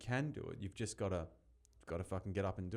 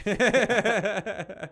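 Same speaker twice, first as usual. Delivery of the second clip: laughing yeah; 11 semitones higher, 12 dB louder than usual. Real, not acted. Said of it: "Oh, cheeky shit".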